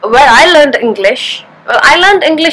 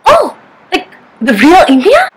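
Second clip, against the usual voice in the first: higher, amazed asker; surprised local; 6 semitones higher than usual. Acted. Local amazed she is from India, higher surprised voice. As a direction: gasp like you can't believe it. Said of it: "acted dialogue as local".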